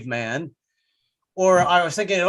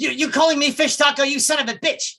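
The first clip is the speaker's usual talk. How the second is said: high-pitched, hammed-up indignant outburst; comic outrage at being called a name; mock insulted; 10 semitones higher, 4 dB louder than usual. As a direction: play an insulted woman, pitched way up and loud. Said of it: "I think I'd be insulted".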